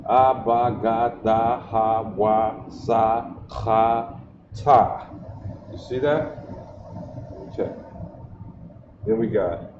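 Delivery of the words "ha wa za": normal talking voice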